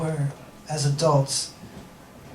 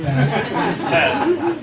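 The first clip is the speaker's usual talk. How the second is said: slow, drawn out through a laugh; 8 semitones higher than usual. Real, not acted. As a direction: stretch the words while cracking up. Laughing at his own joke.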